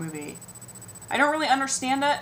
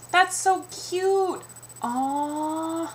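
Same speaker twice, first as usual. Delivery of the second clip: very slow, much higher cooing; mock sugary aww; cooing stranger; 7 semitones higher, much slower than usual. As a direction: coo like it's adorable.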